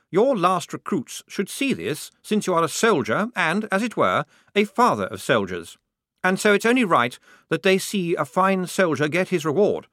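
high-pitched, ceremonious officer voice; upbeat lieutenant. A narrator's reading. Lieutenant honouring an old sergeant before his recruits, ceremoniously. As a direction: make a grand little speech as a lieutenant.

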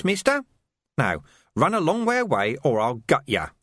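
a mild hint of a leering villain; sneering thug. A narrator's reading. Carcer warning the Major about rebels, lightly sneering.

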